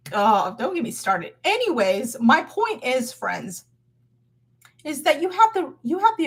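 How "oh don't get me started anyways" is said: ordinary delivery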